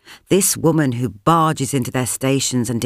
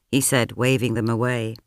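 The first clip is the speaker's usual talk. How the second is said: ordinary delivery, nothing marked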